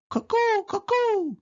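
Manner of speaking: cuckoo clock call. Acted. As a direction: chirp it like a clock bird.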